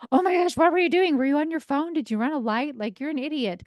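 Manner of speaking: high, frantic, then fading to a scold; mock a freaked-out paramedic blaming the victim; panicky paramedic. Paramedic at a car crash freaking out at the driver, frantic mock scold. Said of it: "freaking out".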